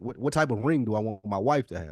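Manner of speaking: ordinary talk, nothing marked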